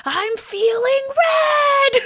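put-on high, slow, wailing child's complaint; mother imitating her crying daughter, high and wailing; whiny kid voice. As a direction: wail it like a crying six-year-old. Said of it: "She's like".

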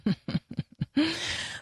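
light laugh to herself; amused chuckle. Real, not acted. Host amused by a headline's Bible nod, chuckling. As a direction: chuckle at a private thought.

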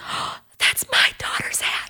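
breath-filled, slowed amazement; retold astonishment, almost all breath; awestruck gasp. Father sees stranger holding daughter's hat, awestruck whisper.

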